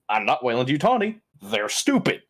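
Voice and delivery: ordinary delivery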